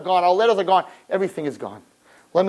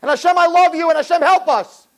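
calling out to God; 8 semitones higher, 4 dB louder than usual. Acted.

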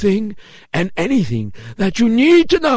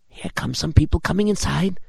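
plain reading voice

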